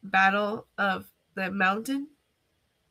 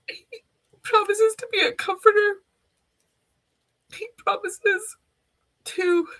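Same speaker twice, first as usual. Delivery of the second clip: higher, tearful and breaking, slowing down; words squeezed out through tears; crying; 8 semitones higher than usual. Real, not acted. Telling viewers God's promises, still crying. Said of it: "I'm sorry for crying".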